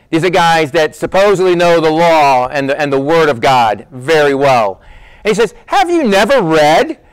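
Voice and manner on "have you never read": "Have you never read?" higher and slower with a rise on "Have"; teasing dig. Shown as a demonstration. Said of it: "it's a little bit of a dig".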